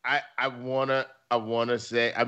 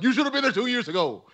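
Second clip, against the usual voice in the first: higher, fast told-you-so; exasperated retort; 9 semitones higher, faster than usual. Retold or imitated. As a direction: scold with a fast high retort.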